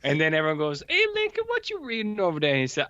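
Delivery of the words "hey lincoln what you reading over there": high mock call from townsfolk. Neighbor calling out to Lincoln, high hammed-up call. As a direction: sing out a neighbor's greeting.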